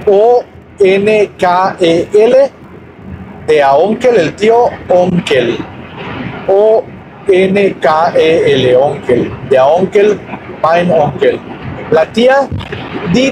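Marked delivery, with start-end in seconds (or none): 0.1-2.5 each letter of "O N K E L" named slowly, one by one; spelled out letter by letter. Shown as a demonstration. Teacher spelling the German word for uncle, letter by letter. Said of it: "Onkel se escribe". 6.5-8.8 each letter of "O N K E L" named slowly, one by one; spelled out letter by letter. Shown as a demonstration. Teacher spelling the German word for uncle, letter by letter. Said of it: "Onkel se escribe".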